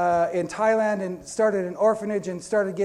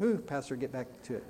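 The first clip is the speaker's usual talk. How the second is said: self-scolding aside; 6 semitones lower, 10 dB quieter than usual. Pretend. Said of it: "Side road".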